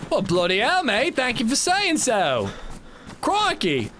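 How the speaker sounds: high, hammed-up British accent. Streamer hams up a British accent for chat, high jolly British. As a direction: put on a jolly cartoon Brit. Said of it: "Your English accent is flawless".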